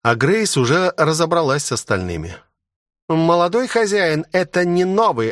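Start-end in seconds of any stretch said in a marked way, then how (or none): 3.1-5.3 higher-pitched report from a subordinate; reporting to the master. A narrator's reading. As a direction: report in, voice lifted. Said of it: "отрапортовала она".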